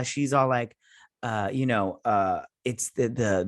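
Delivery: usual speaking voice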